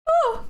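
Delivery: single high "ooh"; short high yelp, tried out; testing jump sound. Shown as a demonstration. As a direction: test out your own hop noise. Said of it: "What kind of sound I make".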